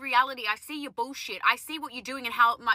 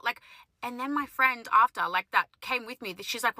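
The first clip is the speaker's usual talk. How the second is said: ordinary talk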